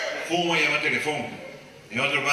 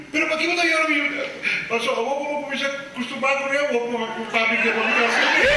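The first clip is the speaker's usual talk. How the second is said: very high, pleading whiny voice; hammed-up pleading; high mock voice; 10 semitones higher than usual. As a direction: whine like a spoiled child. Voicing someone crying child.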